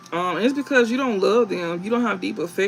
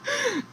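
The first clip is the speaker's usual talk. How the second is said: high giggle; 4 dB quieter than usual. Real, not acted. Laughs at own joke.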